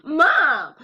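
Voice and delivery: high, loud disbelieving what with a laugh; shocked laughing what. Retold or imitated. Daughter stunned by mom's slow-motion no, high and loud. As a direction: crack up in disbelief. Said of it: "And I was like".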